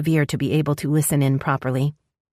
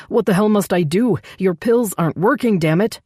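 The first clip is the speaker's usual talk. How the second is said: angry yelling; 5 semitones higher than usual. Acted. Brent yells at the doctor.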